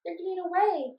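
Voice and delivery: dismay. Acted. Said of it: "Kirsty said in dismay".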